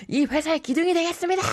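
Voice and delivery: high, fast hammed-up toast speech; mock office toast. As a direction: shout it like an eager new hire. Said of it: "막 이러면서". (회식에서 건배사하는 신입 흉내, 과장된 외침)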